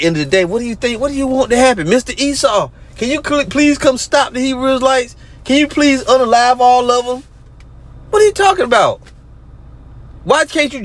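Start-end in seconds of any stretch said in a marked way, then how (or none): 1.9-7.2 mock pleading woman. Mocking the woman begging Esau. 8.1-10.8 exasperated outburst. Real, not acted. Ranting at the woman, exasperated disbelief.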